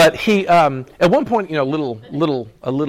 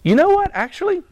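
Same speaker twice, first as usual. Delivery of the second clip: high mock reply. Father Abraham answers the rich man, high and teasing. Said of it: "He goes".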